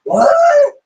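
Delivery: very high, loud shocked "what?"; surprised. Retold or imitated. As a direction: squeal it in disbelief. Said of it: "She's like".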